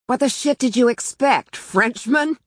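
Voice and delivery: high-pitched mocking question; jokey jibe. A narrator's reading. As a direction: rib them with mock exasperation. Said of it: "he said it like a joke".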